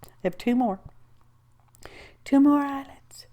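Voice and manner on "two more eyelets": a breathy whispered aside to herself; hushed counting whisper. Real, not acted.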